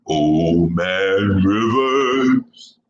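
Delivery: put-on low, very slow Old Man Rivers; deep voice. Shown as a demonstration. Host booms the nickname in deep voice. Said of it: "my deep voice for something".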